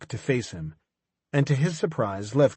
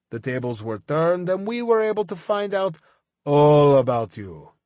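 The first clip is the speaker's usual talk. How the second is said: higher, louder taunting villain, slowing at the end; gloating villain; 5 semitones higher, 4 dB louder than usual. Acted. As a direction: rub his nose in it. Levkin gloating over Deacon.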